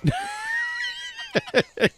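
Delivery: a very high squealing laugh; shrieking laugh. Real, not acted.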